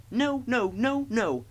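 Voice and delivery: high, loud chorus of refusal; silly trucks. A narrator's reading. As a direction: wail it out as unruly trucks. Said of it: "answered the trucks".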